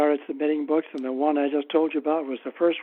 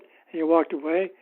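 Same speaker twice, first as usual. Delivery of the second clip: ordinary talk, nothing marked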